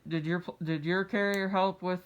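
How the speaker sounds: normal talking voice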